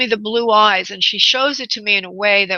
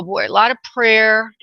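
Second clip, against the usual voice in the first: usual speaking voice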